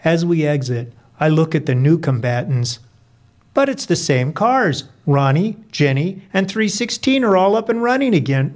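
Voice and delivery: usual speaking voice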